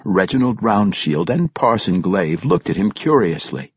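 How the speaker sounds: plain reading voice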